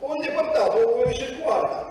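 ordinary talk, nothing marked

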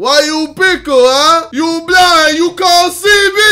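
high, loud mock cartoon squeal; Brian. Reacting streamer mimics the video's dog, loud high impression. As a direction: shout it in a shrieky impression. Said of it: "what Brian sounds like".

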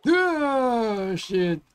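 startled. Real, not acted. Sudden fright in game.

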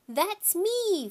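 put-on high, loud toddler voice; excited little boy. A narrator's reading. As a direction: pipe up like a proud little kid. Toddler claims to be on TV.

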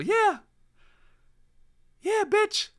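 very high squeaky yeah; Blocky voice. Retold or imitated. Streamer showing his Blocky impression, very high and squeaky. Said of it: "My Blocky voice was a little".

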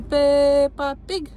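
very slow, drawn-out Peppa Pig; slow syllable-by-syllable prompt. Shown as a demonstration. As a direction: coax a child to repeat, each syllable stretched. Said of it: "Spune Peppa".

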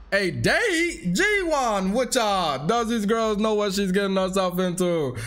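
high-pitched, hammed-up Jamaican accent; terrible Jamaican accent. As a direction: do Lifeline a bit too high in bad Jamaican. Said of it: "my Jamaican accent is terrible".

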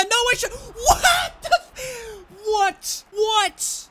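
high, loud exasperated yell with a cracked laugh; frustrated yell. Real, not acted. Gamer yelling at his own team's blunder, exasperated shout. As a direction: let it burst out, half laughing in outrage. Raging at a missed chance.

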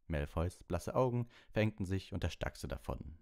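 ordinary delivery

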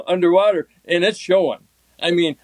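usual speaking voice

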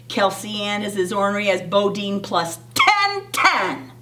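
ordinary delivery

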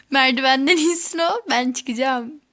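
normal talking voice

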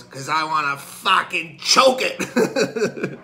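slow, emphatic retort; acted exasperated outburst. Father tells farmer why he wants turkey, exasperated. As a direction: hammer each word out slowly, building to a punchline.

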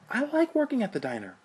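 snide high-pitched defiance to his brother; sarcastic boast. Acted. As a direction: overplay the love for the diner, very high.

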